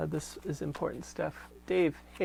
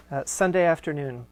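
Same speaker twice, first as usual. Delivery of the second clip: steady, fuller read-aloud voice; letter reading; 6 dB louder than usual. Acted. Host reading Corinne's letter aloud to listeners, measured reading voice. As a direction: read the old letter out with care. Reads Marshall's reply aloud.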